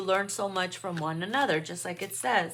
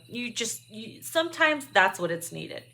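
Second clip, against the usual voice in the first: usual speaking voice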